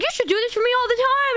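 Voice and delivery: high, fast, eager gush; delighted friend. Retold or imitated.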